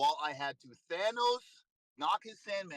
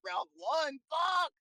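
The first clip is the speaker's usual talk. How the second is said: frustrated curse; 10 semitones higher, slower than usual. Retold or imitated. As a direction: yell at yourself for losing round one. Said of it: "I'm like".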